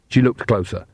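plain reading voice

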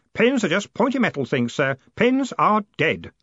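put-on high boyish voice, scornful; put-on boy's voice, emphatic; dismissive Stanley. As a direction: write off an old hobby, slowing on the last words.

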